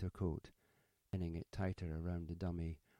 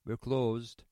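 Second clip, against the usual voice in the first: loud, higher-pitched curt refusal; sharp retort; 5 semitones higher, 9 dB louder than usual. A narrator's reading. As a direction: retort it loudly to a late caller. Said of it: "Beeton would have retorted".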